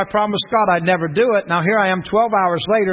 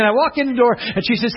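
ordinary talk, nothing marked

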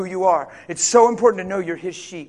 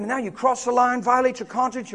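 ordinary talk, nothing marked

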